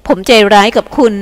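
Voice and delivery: ordinary delivery, nothing marked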